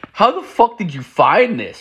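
can't believe he found this; shocked question. Retold or imitated. Asking his friend how he found it, high incredulous shock.

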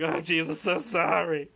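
flustered apology. Pretend. Fan apologizing to her idol after embarrassing herself, flustered. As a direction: stammer out a mortified sorry.

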